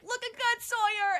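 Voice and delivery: a hint of excitement; cheerful greeting. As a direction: greet him with a big grin in the voice. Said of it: "Dane's like".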